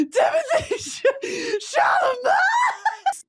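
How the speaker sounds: delighted fangirl screaming Timothée Chalamet; ecstatic scream. Real, not acted. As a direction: shriek his name like a fan. Screaming a crush's name.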